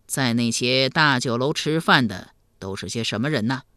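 low, grave elder's voice; deep, measured inquiry; questioning emperor. Acted. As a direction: probe soberly, as a concerned ruler.

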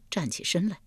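ordinary delivery, nothing marked

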